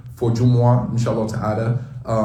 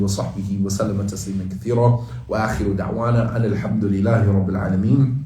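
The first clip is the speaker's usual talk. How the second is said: fast, lower recited dua into Arabic formula; closing prayer; 4 semitones lower, much faster than usual. Acted. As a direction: rattle off the closing supplication like a practiced imam.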